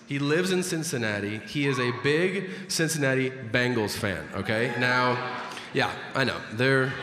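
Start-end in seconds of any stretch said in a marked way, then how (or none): none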